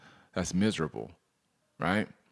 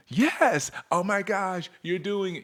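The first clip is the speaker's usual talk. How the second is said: thrilled customer; 9 semitones higher, slower than usual. Retold or imitated. Delighted customer voiced in example.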